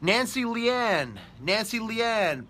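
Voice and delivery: slow, high, stretched call of the name, like a ring announcer. Acted. Host announcing the day's winner, stretched and high.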